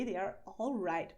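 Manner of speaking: ordinary talk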